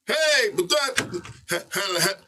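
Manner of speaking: long high loud "hey" into a stutter; ad-lib holler. Acted. Rapper re-creating his studio intro for the host, hollered. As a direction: belt out a record-opening shout.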